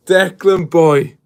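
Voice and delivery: loud happy call, name drawn out; cheering a mate's arrival; excited greeting. Real, not acted. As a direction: hail him warmly across the room. Greeting friend again.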